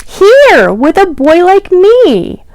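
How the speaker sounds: light, delighted little cat; stray cat's happy answer. Acted. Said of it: "If I were a stray cat".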